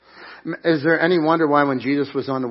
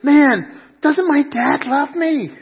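put-on high, slow pleading little girl; a child begging, high and drawn out; hurt child's plea; 12 semitones higher, 8 dB louder, slower than usual. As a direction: plead like a heartbroken kid. Girl crying in store, retold.

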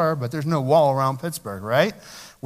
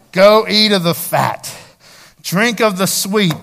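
louder, slow festive command; joyful proclamation; 2 semitones higher, 7 dB louder than usual. Acted. Nehemiah sends the people to feast, loud and slow. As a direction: call them to the feast.

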